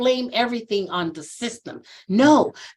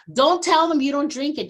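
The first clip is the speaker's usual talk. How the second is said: normal talking voice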